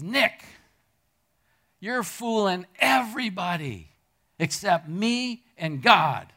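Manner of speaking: loud, slow scolding poke at the chest; stern rebuke. Retold or imitated. Old church aunt scolding a young man, loud and slow. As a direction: lay down the truth to a teenage boy.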